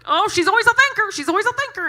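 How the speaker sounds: high, loud, giddy squeal; mock a nervous kid gushing; hammed-up excitable clerk; squeaky teen clerk.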